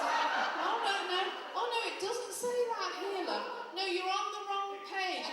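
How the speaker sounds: high, loud bickering back and forth; two pensioners arguing over the page; squabbling old students. Retold or imitated.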